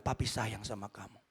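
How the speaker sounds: low, soft fatherly voice; tender fatherly affection. Retold or imitated. Father hugging departing son, low tender declaration.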